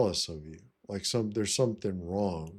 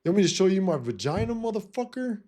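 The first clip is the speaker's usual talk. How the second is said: much higher, fast, incredulous retort; mock outraged comeback; 10 semitones higher, faster than usual. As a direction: fire the comeback back at him, fast. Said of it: "I said".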